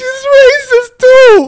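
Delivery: very high, loud, drawn-out cry; gleeful outraged exclamation. Real, not acted. Telling friends about the insult, loud high-pitched disbelief. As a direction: shriek it slowly, stretching the words.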